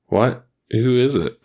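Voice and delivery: puzzled. A narrator's reading. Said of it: "Trent asked".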